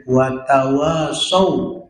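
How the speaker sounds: wa tawasau chanted slowly with the long vowels held; drawn-out recitation. Shown as a demonstration. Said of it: "mad tobe'i dua harkat". (wa tawasau dibaca pelan dengan mad dua harkat)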